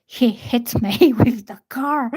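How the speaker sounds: breaking into a laugh mid-quote. Retold or imitated. Telling the receptionist he hit her, laughing. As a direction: retell the absurd answer through laughter. Amused retelling of reception mix-up.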